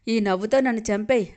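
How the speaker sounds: plain reading voice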